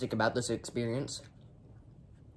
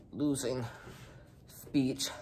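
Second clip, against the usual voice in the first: lisp; 5 dB quieter, slower than usual. Real, not acted. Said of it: "I'm developing a lisp".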